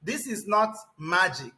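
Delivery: ordinary talk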